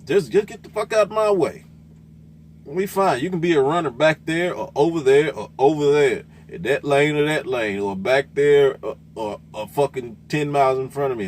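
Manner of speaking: high, loud exasperated yell; heated yell. Real, not acted. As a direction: yell it out, totally fed up. Ranting at other truck drivers.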